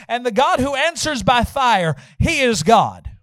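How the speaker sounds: loud, ringing declamation; preaching scripture. Acted. Elijah to all the people, forceful preached reading. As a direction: drive the challenge home to the congregation.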